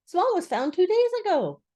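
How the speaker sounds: high, loud announcement; chirpy Rabbit. A narrator's reading. Rabbit breaks news to Eeyore.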